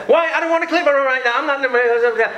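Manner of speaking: put-on high whiny teen back-talk; mouthy teen son. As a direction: whine back like a sulky teenager. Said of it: "My son opens the mouth to me".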